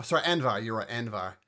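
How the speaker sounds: normal talking voice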